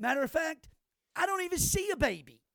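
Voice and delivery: sarcastic topper. Retold or imitated. Joking back at the humorless woman, mock-incredulous and loud. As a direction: crank it up for the punchline. Topping his own sarcastic remark.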